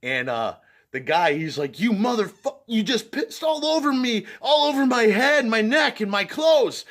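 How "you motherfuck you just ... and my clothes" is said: much higher, louder enraged yell; furious yelling. Retold or imitated. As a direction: rage at the guy who soaked you. Pissed-on stranger yells at him.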